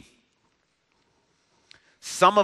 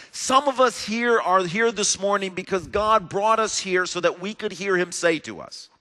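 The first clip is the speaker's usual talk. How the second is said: normal talking voice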